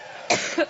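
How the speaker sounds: short dry cough; chesty cough. Real, not acted. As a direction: cough mid-speech. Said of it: "she had quite a coughing fit".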